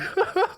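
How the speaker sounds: a short high giggle slipping out; real high giggle. Real, not acted. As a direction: giggle helplessly. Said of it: "I just keep laughing".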